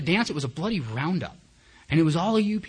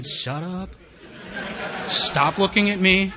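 mock exasperation; 6 semitones higher, 4 dB louder, much slower than usual. Trapped newcomer snapping inwardly at starers.

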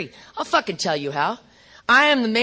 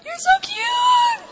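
very high, drawn-out squeal; slow, sky-high gushing baby talk; doting God, squealing; much slower than usual. Acted. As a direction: squeal it like cooing over a baby. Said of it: "And God says".

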